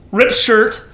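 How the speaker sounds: usual speaking voice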